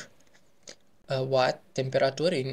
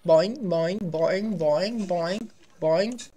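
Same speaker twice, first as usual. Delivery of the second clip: bouncy boing sounds; 3 semitones higher, much slower than usual. Pretend. Mimicking bouncing.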